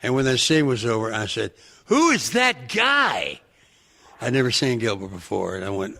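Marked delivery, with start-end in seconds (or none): 1.8-3.4 high, drawn-out mock amazement; slow, high-pitched incredulous cry; astonished outburst. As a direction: reenact being floored by a stranger. Acting out his amazed first reaction.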